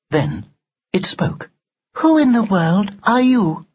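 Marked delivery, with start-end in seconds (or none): 2.0-3.7 higher, prim Victorian doll; lightly voiced haughty doll. Doll speaks for first time.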